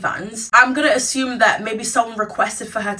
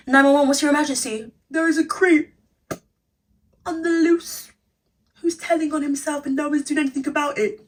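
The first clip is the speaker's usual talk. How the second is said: high, dramatic caller voice; mock emergency call; 7 semitones higher than usual. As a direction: play a panicked caller. Acted emergency call skit.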